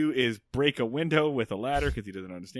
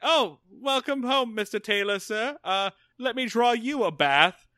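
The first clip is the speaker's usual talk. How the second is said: mock posh butler; 5 semitones higher than usual. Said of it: "it's like".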